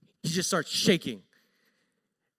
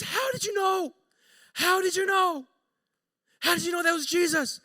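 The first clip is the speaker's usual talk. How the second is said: high, insistent questioning; astonished demand; 11 semitones higher, 5 dB louder than usual. Retold or imitated. Coworker asking the wife how she knew, astonished. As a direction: repeat the question in high, loud disbelief.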